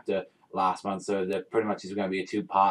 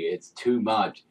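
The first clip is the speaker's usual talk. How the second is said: ordinary delivery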